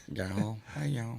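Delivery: Georgia accent. Shown as a demonstration. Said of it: "Georgia accent".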